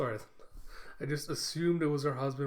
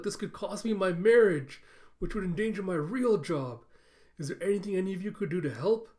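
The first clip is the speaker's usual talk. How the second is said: higher, louder acted pleading; mock desperation; 6 semitones higher, 6 dB louder than usual. Yor begs her coworkers for help, mildly desperate. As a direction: lightly play someone begging for help.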